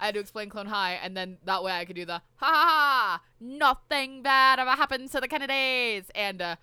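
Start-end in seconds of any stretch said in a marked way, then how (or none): 2.4-6.1 loud mock Kennedy accent with a fake laugh; JFK voice. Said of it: "in the J F K voice".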